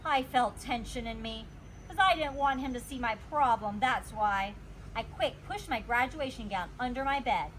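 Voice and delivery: plain reading voice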